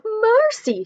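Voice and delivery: high alarmed cry of mercy; mother's cry. Acted. Mother crying out at shivering son, high and alarmed. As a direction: cry out in shock. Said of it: "she cried".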